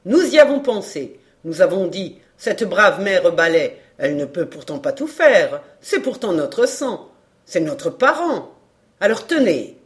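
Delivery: loud, brash fishwife chatter; exclaiming market woman. Acted. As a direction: announce the gift at full voice. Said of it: "s'écria la grande Hocquart". (boniment sonore de marchande)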